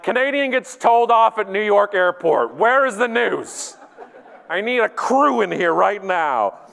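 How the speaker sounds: high, slow, indignant demand; mock outrage. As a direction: call out for news cameras in overblown outrage. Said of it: "I'm like".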